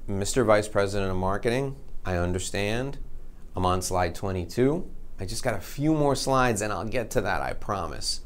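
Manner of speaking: calm him down quietly; soft placating. Retold or imitated. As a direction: salesman soothing the angry executive. Placating the angry executive.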